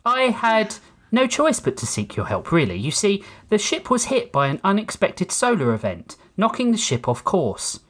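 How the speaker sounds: courteous synthetic steward, measured and prim; calm robot butler. A narrator's reading. Ship's robot asking passenger for help, calm and polite.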